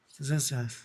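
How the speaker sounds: very soft, insecure mumble; confused mumble. Retold or imitated. Embarrassed new salon owner telling a client his price, mumbled. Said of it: "Eu falava meio confuso".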